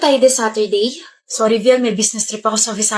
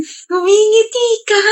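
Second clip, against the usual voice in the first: teasing; 10 semitones higher, slower than usual. Acted. Teasing friend who is smiling.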